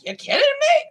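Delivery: high, incredulous quip; mock disbelief. Women in the audience reacting, mock disbelief. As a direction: scoff as the women would. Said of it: "the women are like".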